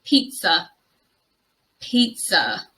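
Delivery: "pizza" as PEET-suh; correct pronunciation. Shown as a demonstration. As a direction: model "pizza" clearly, stress on PEET. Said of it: "It is pronounced".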